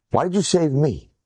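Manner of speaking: ordinary talk, nothing marked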